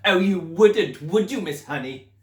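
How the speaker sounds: mock her with a booming sneer; mocking Trunchbull. Headmistress taunting the young teacher with a sneer. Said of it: "the Trunchbull said".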